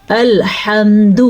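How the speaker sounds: الحمد slow with a short final د, not lengthened; short dal. Shown as a demonstration. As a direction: keep the دال of الحمد short. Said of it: "اس طریقے سے پڑھنا ہے". (الحمد کی دال کو لمبا نہ کریں)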